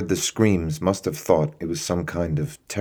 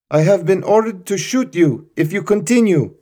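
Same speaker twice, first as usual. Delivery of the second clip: high, louder official threat; cold loud warning; 6 dB louder than usual. Acted. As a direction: state the order to shoot like a final notice. Guard relays shoot order.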